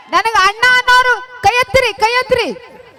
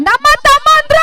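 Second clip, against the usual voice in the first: loud, raised-pitch holler; calling out; 7 semitones higher, 6 dB louder than usual. Pretend. Performer hails younger brothers in the crowd, loud call. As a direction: throw it out to the back rows.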